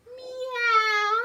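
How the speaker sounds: high, loud playful meow; playful high kitty meow; cat meow. Shown as a demonstration. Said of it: "we can make the sound".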